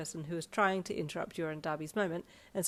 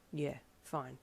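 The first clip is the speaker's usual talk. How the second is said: curt, understated gruff reply; 3 semitones lower, 4 dB quieter than usual. A narrator's reading. Darby approving the wine label, gruff. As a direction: brush it off gruffly. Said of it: "Darby replied gruffly".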